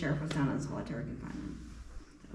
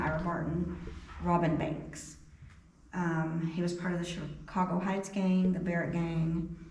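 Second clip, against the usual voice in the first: usual speaking voice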